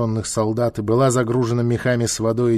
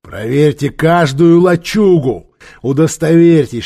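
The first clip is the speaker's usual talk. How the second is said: loud, slow, raised order; commanding; 5 semitones higher, 9 dB louder than usual. Acted. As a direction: bark an order at the troops. Warlord orders soldiers to search.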